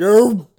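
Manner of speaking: loud, high fake retch; gag sound. Shown as a demonstration. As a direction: retch into the silence. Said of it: "it's like".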